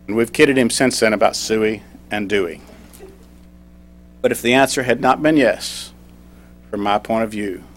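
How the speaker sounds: ordinary talk, nothing marked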